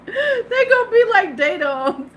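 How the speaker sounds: high, loud laughing words. Real, not acted. Joking about robot faces, laughing. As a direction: laugh through it.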